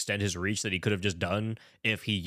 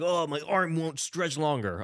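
higher, drawn-out mock complaint; mock helpless; 5 semitones higher, slower than usual. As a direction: put on a feeble, stretched-out excuse. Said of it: "He's just like".

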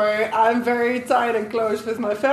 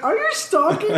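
high, laughing tease; a half-laughing, raised-pitch "stalking me?"; laughing mock accusation; 9 semitones higher than usual. Telling the guy on the boat, laughing disbelief.

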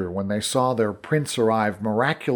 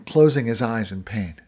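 plain reading voice

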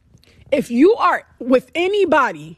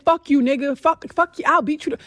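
retold cussing out; 4 semitones higher than usual. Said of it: "the moment I turned into that girl".

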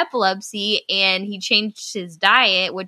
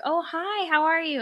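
much higher, cheery fake-friendly hello; bright mock greeting; 10 semitones higher than usual. Speaker greeting the returning customer, high and chirpy. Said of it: "I'm like".

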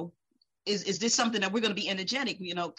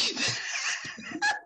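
high breathy laugh. Real, not acted. Laughing at own story.

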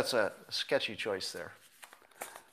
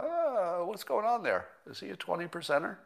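puzzled high wonder; 6 semitones higher than usual. Retold or imitated. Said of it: "you look at it and you go".

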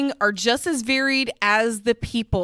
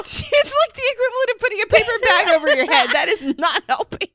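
high, fast giggling complaint; trying not to laugh; 11 semitones higher, 4 dB louder than usual. Real, not acted. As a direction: talk through a giggle fit. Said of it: "I can't stop".

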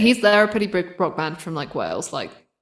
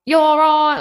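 louder, high-pitched London greeting; London accent; 9 semitones higher, 5 dB louder than usual. Shown as a demonstration. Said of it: "London is a bit more like".

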